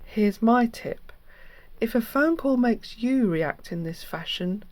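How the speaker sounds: normal talking voice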